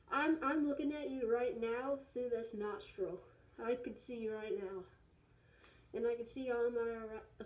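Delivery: muffled inside full head mask; through a rubber mask. Real, not acted. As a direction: voice behind covered face. Seen 3: Full latex horse mask covers the whole head in every labelled frame.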